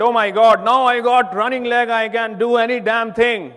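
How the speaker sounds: loud, high, overjoyed cry; exuberant. Retold or imitated. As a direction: shout it like you just got your running leg. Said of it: "So I was, you know, exuberant".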